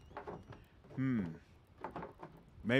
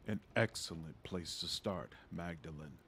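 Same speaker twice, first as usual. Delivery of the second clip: put-on old father voice; 7 semitones lower, 10 dB quieter than usual. Father figure in game dialogue.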